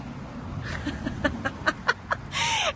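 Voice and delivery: a breathless laugh of sheer disbelief, high and airless; breathy disbelieving laugh. Real, not acted. Hearing the fibroid isn't real, breathy stunned laugh. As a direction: laugh out in astonished relief. Reacting to the specialist's news.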